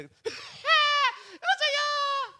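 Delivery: drawn-out high shouted wail with a laugh; a laughing, overblown shout of Jesus' name; mock wailing cry. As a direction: ham up a desperate cry to Jesus. Mocking a dramatic cry to Jesus.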